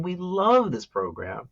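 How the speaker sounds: love drawn out and pitched high; slow, high swell on love to show feeling; stress on love. Shown as a demonstration. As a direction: lean hard on the word love. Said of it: "one is about how we feel".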